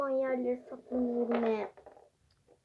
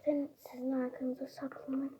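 whisper; 10 dB quieter than usual. Pretend. Hiding, telling others where.

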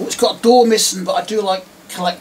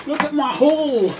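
mock haul showoff; 8 semitones higher, slower than usual.

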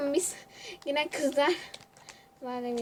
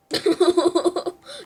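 loud shouted laugh; 4 semitones higher, 9 dB louder than usual. Real, not acted. Streamer laughing loudly at her own wrong jump.

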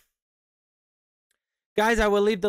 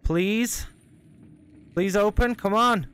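desperate pleading; 5 semitones higher, slower than usual. Real, not acted. Begging door to open.

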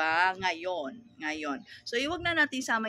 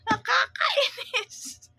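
drawn-out, higher, whiny "nakakainis"; exasperated whine; 13 semitones higher, 4 dB louder, much slower than usual. Acted. Store owner fed up with kids who won't speak, slow high whine. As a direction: stretch it out in a high whine of annoyance.

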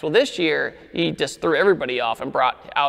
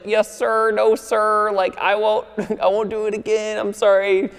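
lightly higher, nervous yes-sir pleading; mock stiff apology; 5 semitones higher than usual. Friend pulled over, groveling to the officer in a lightly mocking voice. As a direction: imitate a friend groveling to a cop. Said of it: "all stiff and just like".

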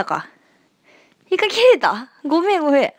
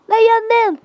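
mock Kansai retort; 12 semitones higher, 5 dB louder, faster than usual.

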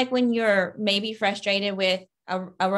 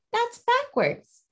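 high squeaky surprise, slow; cat's surprised thought; slower than usual. Acted. Cat character catching the backwards spelling, high surprise. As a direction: voice the cat's shocked inner thought.

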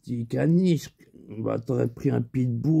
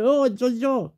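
Lascar's voice; 10 semitones higher than usual. Retold or imitated. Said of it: "il me fait".

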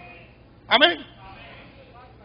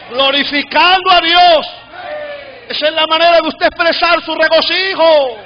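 raised, ringing voice with long stretched endings; impassioned preaching; 5 semitones higher than usual. Real, not acted. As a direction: exhort the crowd with full conviction. Impassioned preaching at full force.

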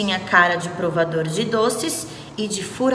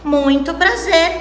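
cheerful greeting; 8 semitones higher, 4 dB louder than usual. Acted. All greet together.

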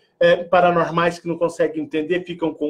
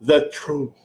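posh English; 2 semitones lower than usual. Shown as a demonstration. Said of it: "mais chique".

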